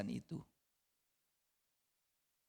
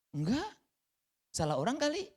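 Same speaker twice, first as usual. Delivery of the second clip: Peter denying; 12 semitones higher, 4 dB louder than usual. Acted. Peter denies knowing Jesus.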